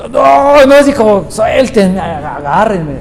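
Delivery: hammed-up hothead yelling to be let go; mock fury, let me go; mock angry outburst. Angry person feigning restraint.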